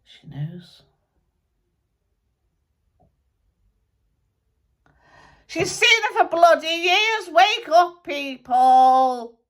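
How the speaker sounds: a dead rocker grumbling through a medium; channelled musician spirit. Acted.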